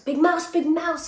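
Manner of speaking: high, loud plea; lonely little mouse calling out, high and loud; crying out. A narrator's reading. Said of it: "he cried".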